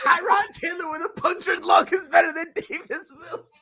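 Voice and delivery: high, cracking-up mimicry; squealing amused incredulity; laughing disbelief. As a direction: repeat the claim through helpless laughter. Laughing at co-host's claim.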